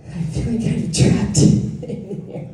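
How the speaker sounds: real laugh breaking into the words; slow, words breaking up with laughter; laughing while talking. Real, not acted. Speaker feeling trapped in here, laughing.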